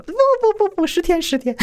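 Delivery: very high-pitched mimicked voice; comic falsetto imitation of the other man; imitated hasty denial. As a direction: squeak out his correction in a mock high voice. Retells the other man's frantic correction.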